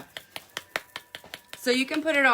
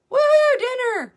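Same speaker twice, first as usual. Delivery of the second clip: much higher, loud cheer of woohoo dinner; excited whoop; 7 dB louder than usual. Real, not acted. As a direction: whoop it out like dinner just arrived. Sudden call about dinner.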